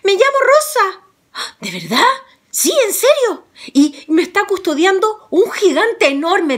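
a little princess warning the hero; frightened princess. Acted. Rosa warns Juan about the giant, high and girlish.

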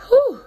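loud winded exhale, high; breathless whew. Real, not acted. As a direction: blow out a tired breath. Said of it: "I'm running out of breath".

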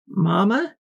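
put-on high, questioning small-child voice; a child checking timidly on mama; tiny high-pitched filly voice; little child. A narrator's reading. Small child calls to crying mother.

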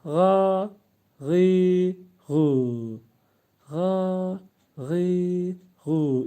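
ga, gi, gu without straining the throat; gentle ga gi gu. Shown as a demonstration. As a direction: ease out ga gi gu. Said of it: "İncitmeden".

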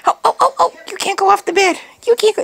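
high, urgent cooing to a puppy. Real, not acted. To the puppy nearing the bed edge, high urgent baby talk. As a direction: coax her back from the edge, up high.

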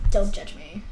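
ordinary talk, nothing marked

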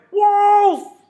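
very high, very loud cry of alarm; shout. Acted. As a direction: yell it to the whole valley.

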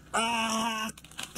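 disappointed groan. Real, not acted. Last card misses his favourite.

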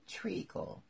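high, whispery drowsy murmur; half-asleep little voice; sleepy voice. A narrator's reading. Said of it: "said a sleepy voice behind her".

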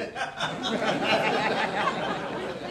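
hearty laugh. Real, not acted. Laughs at own football story.

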